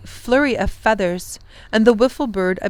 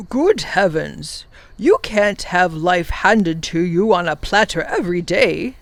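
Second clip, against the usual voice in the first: brisk professor; 4 dB louder than usual. Acted. The professor teases the children about easy life, hearty. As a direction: gently scold with good humour.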